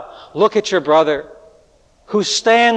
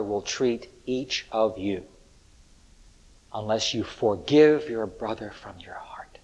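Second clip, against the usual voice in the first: hushed warning whisper, lower pitch; 8 semitones lower, 10 dB quieter than usual. Real, not acted. Preacher warning his congregation in a low whisper. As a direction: breathe the warning like a secret.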